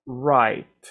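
right with a clear final t; teacher's model. Shown as a demonstration. Teacher shows students how right sounds, clear final t. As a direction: say right, landing the t at the end. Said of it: "suena de esta manera".

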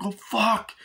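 high-pitched mock shock; acted alarm. Retelling the amazed look mid-chase, acted alarm. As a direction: let the pitch jump in alarm.

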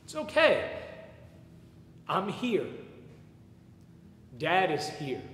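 fighting back tears while quoting dad; choked-up dad's words. Real, not acted. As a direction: voice breaking on the first words, then steadier. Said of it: "It's still an emotional story for me".

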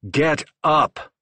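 high, loud barked order; commanding yell. A narrator's reading. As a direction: yell at his son. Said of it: "his father yelled".

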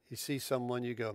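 ordinary talk

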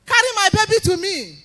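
mother demands her baby from the doctor, loud retold cry.

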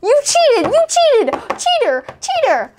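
high, loud playful accusing yell; mock accusation. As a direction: call out the cheater, half joking.

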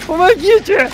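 high, desperate cry for help; man down shout. Acted. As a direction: beg for rescue in a squeal. Downed player begging for help.

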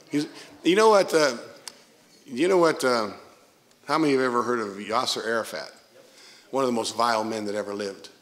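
normal talking voice

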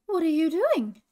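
high, loud called question; calling out. A narrator's reading. Hooter finds Ferguson jumping up and down, calling out. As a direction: call it across, puzzled. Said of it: "called Hooter".

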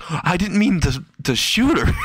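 high-pitched, flustered objection; acted high panic; panicked protest. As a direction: protest in mock horror. Protests after unintended shooting.

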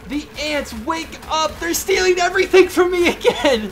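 high, loud, frantic yelling at the ants; frantic shouting. Real, not acted. Knocked-out player yelling at ants, loud frantic. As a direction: panic as everything gets stolen.

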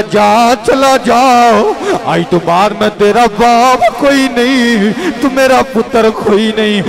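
high, loud furious shout at the son; father's shout. A narrator's reading. Father disowning the son who slapped him, loud shout. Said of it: "ਪਿਓ ਕਹਿਣ ਲੱਗਾ".

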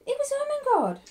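very high, loud exclamation; spring the surprise with a big leap in pitch; astonished reveal. A narrator's reading. Narrator revealing who waits in the attic, astonished.

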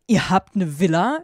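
loud, moderately exclaimed question; mock yell. A narrator's reading. Heroine astonished at the boys' villa, half-shouting. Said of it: "schrie ich".

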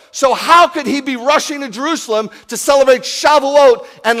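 loud, high, fervent sermon delivery; preaching at full force. Acted. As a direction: preach it like the hall is packed and doubting. Preaching about Shaul and Shavuot.

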